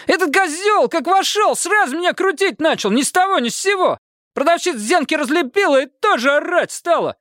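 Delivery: very high-pitched agitated complaint; thin, outraged cry. A narrator's reading. Detainee complaining he was beaten by guard, thin high cries. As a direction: pour out grievances in a squeaky thin voice. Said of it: "тонко выкрикнул он".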